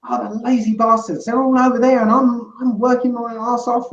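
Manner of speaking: high whiny moaning voice.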